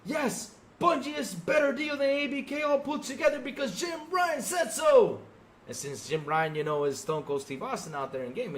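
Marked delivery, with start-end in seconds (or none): 0.1-5.2 mocking excited fan. Streamer mocking gullible Sony fans, high and shouty. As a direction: ham up a gullible fanboy. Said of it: "the fans were out there saying".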